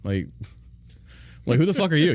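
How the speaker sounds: ordinary talk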